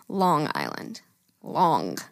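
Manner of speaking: Long Island said with a nasal Long Island twang; Long stretched in Long Island accent. Shown as a demonstration. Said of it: "No, but Long Island".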